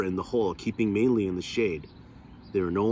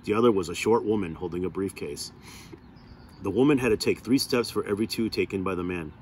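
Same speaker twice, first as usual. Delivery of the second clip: ordinary delivery, nothing marked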